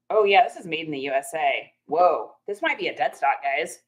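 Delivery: usual speaking voice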